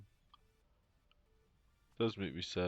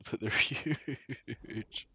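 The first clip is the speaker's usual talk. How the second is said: real laugh, very slow, louder and higher; 5 semitones higher, 6 dB louder, much slower than usual. Real, not acted. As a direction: laugh through it at how huge they are. Laughing at the huge fish.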